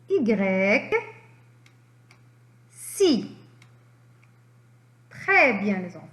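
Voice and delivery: ordinary talk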